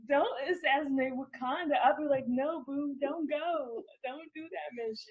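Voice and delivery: put-on high-pitched playful plea to a boyfriend; mock pleading. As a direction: beg him not to go, hamming it up. Plays girlfriend begging assassin boyfriend.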